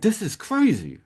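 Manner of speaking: high, sharp burst of disbelief; exasperated. Retold or imitated. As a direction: throw it up an octave like you can't believe it. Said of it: "because I was just like".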